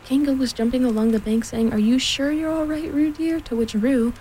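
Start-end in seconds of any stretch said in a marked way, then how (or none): 1.7-3.4 high, fretful motherly call; anxious calling. A narrator's reading. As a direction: keep checking on your child, pitched high. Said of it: "called Kanga anxiously".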